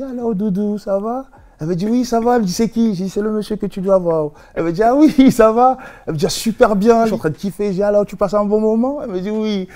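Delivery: high-pitched put-on Creole sweet talk; amateur Creole accent, high and lilting; attempted Creole accent. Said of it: "je vais essayer de faire l'accent créole".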